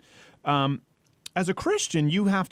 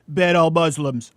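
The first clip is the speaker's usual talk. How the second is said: mocking Trump; 7 dB louder, slower than usual. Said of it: "like nobody else said it".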